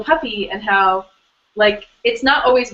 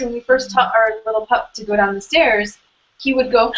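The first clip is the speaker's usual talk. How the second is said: ordinary delivery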